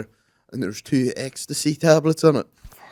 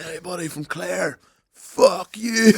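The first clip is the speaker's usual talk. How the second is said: put-on rowdy drunk heckle; 7 semitones higher, slower than usual. Drunk lad walking down aisle.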